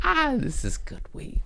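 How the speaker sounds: high, squeaky delight over the weed; savoring. Real, not acted. Stoned host praising the weed in a high squeak.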